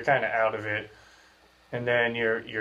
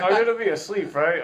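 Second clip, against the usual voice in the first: laughing; 9 semitones higher, 4 dB louder than usual. Retold or imitated. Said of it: "I was like".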